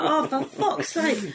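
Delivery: slow, drawn-out exasperated sigh; mock exasperation. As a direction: act fed up with lazy friends. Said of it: "You're like".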